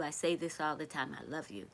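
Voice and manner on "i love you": much lower and softer, tender. Real, not acted. Streamer repeating her love softly to viewers. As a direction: let it sink to a soft, low murmur.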